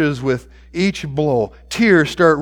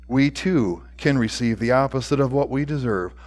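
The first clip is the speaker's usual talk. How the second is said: ordinary delivery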